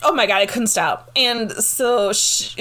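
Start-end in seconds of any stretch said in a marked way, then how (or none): none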